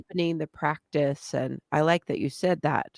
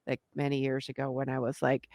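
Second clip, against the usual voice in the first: usual speaking voice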